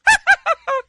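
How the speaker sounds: high hammed-up principal laugh; cartoon principal cackle; principal's laugh. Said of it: "[name]'s fake principal balding laugh".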